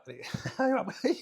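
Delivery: high burst of real laughter; real laugh recalling the goal; excited laugh. Real, not acted. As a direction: laugh with delight.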